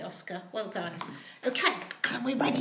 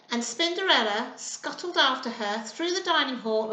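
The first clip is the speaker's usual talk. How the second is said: ordinary delivery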